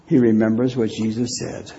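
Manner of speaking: ordinary talk, nothing marked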